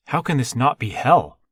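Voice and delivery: loud, high indignant protest; incredulous outburst at full voice. A narrator's reading. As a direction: protest it loudly, pitch jumping up. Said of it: "He raised his voice".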